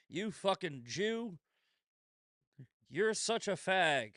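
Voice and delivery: slow, relishing read of the insult; mocking read. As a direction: savour each word as a treat. Reading hate mail aloud.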